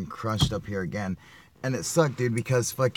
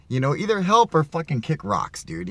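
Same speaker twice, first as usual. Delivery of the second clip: higher, louder retold snap at the onlookers; fed up; 5 semitones higher, 5 dB louder than usual. Telling the two watchers to help or leave, irritated. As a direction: tell them off, half-joking. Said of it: "I'm just like".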